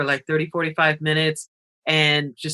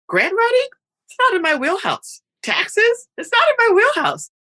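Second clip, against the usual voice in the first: playful high admission; 3 dB louder than usual. Real, not acted. Self-deprecating admission, high.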